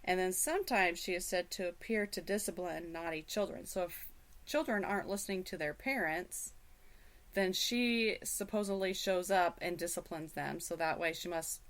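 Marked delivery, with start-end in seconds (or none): none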